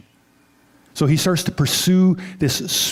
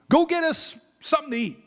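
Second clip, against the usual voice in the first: much higher voice for Jesus; tossing an errand, pitched up; lightly voiced Jesus sending them to town; voicing Jesus; 10 semitones higher than usual.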